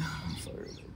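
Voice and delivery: barely audible whispered echo; mocking whisper. Parroting co-host's irritation, mocking whisper. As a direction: whisper it back to tease.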